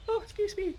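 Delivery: mid-yawn, voice jumping high. Real, not acted. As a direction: talk through a yawn. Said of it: "Caught unawares by a yawn".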